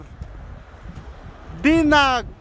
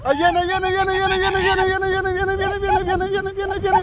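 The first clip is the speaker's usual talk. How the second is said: higher-pitched rapid-fire chant of "viene"; excited running commentary; 5 semitones higher than usual. Real, not acted. Watcher calls out a sledder coming down the snow, excited chant. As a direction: call the sled coming in, high and nonstop. Calling a sled run coming down.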